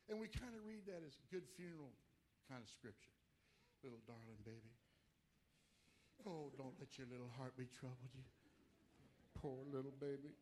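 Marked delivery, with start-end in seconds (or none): 3.8-10.3 put-on soft, lower, babying croon; mock sweet lullaby to a baby; mock tender coddling. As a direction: coo it like soothing a baby, tongue in cheek. Mocking funeral-style comforting reading.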